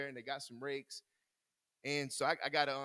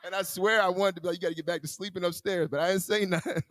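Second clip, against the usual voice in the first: louder and higher, laughing through the words; laughing aloud; 5 semitones higher, 11 dB louder than usual. Real, not acted. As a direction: chuckle your way through the joke. Joking about wife, laughing loudly.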